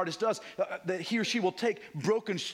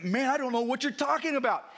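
raised, higher protest; emphatic denial; 7 semitones higher, 3 dB louder than usual. Retold or imitated. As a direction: insist hard that you never knew him. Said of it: "But Peter said emphatically".

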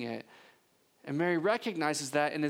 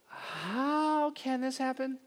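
slow, high, astonished question; stunned disbelief; 7 semitones higher, much slower than usual. Retold or imitated. Mary answering the angel, slow and astonished. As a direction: let the disbelief stretch the words out. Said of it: "Mary's response to the angel is".

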